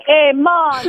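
a light mock of a fake TV Jamaican accent. Guest mocks TV Jamaican accents to the host, light mock Jamaican accent. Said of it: "American bobslayer people, like".

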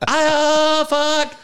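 high, flustered, fumbling numbers; rattled and laughing while typing; shaky panic. Retold or imitated. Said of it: "So shaky".

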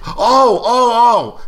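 very high, loud "oh"s; sudden realization. Retold or imitated. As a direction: let the penny drop loudly. Reenacts Larry Gordon getting it.

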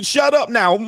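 high, loud parental yell; mock yelling parent. Parent silencing a crying child, high mock yell. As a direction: shout down the crying kid.

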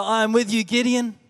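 ordinary delivery

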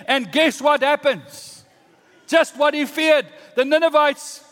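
high, loud, emphatic preacher; full-force preaching. Real, not acted. Preacher telling of Nineveh, at full force. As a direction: build the suspense at full volume.

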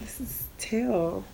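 slow, soft raspy introduction. Real, not acted. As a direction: introduce yourself in a quiet rasp. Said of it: "me with the raspy voice".

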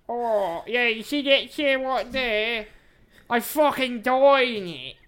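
high, loud, slow demon voice; Dead Man Bill. Acted. Host showing where he died in the chair, hammed-up demon voice. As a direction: stretch every word, a hammed-up dead man complaining. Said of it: "is when the demon voice started coming along".

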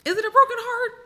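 high skeptical squeal; skeptical mock squeal. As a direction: squeak it out in disbelief. Mocking the broken heart excuse.

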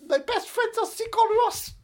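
high, loud mock Icelandic singer; hammed-up high put-on voice. Host plays Icelandic singer naming friends, high impression. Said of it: "I think [name] has more things to say".

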